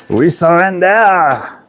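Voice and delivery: high, slow mock French wail. Joking to the camera in a hammed-up French voice. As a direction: camp it up as a surrendering Frenchman.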